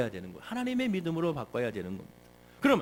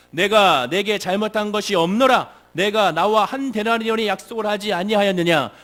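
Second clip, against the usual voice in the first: solemn scripture reading; 2 semitones higher, 8 dB louder than usual. Shown as a demonstration. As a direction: proclaim the master's words to the congregation. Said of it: "우리 다 같이 한번 화면 함께 읽어보겠습니다". (크고 느리게 성경 낭독)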